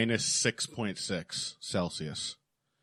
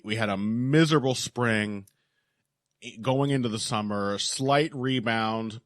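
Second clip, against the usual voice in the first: ordinary delivery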